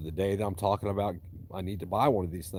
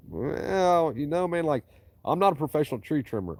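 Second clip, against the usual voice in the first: imitating the customer; 11 semitones higher, 7 dB louder than usual. Retelling customer's words.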